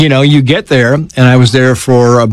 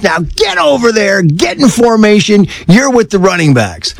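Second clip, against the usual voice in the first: high-pitched commanding coach; coach's order; 8 semitones higher than usual. Retold or imitated. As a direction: order the kid into formation. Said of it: "he goes".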